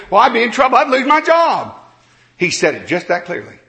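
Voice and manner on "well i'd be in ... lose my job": mock alarmed. Retelling a preacher's fear for his job, raised. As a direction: stretch out the fear. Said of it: "retold preacher's worry".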